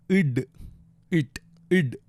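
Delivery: "id" as an isolated closed sound; letter sound demo. Shown as a demonstration. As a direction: sound out "id" with pauses between repeats. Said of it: "it's pronounced like".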